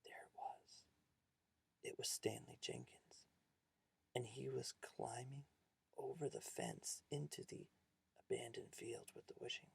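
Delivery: very soft, near-whispered storytelling; hushed suspense. A narrator's reading. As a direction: drop to an eerie hush.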